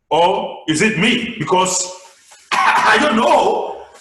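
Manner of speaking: loud, slow, laughing; loud laughing shout. Shown as a demonstration. Teacher to class, loud laughing shout. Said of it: "let your voice go high and low".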